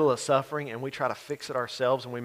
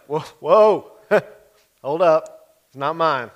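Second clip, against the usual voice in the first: loud, higher shocked whoa; startled protest; 3 semitones higher, 12 dB louder, slower than usual. Acted. Joseph reacting to pregnant Mary, loud shocked protest. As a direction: pull back in alarm, hold up!.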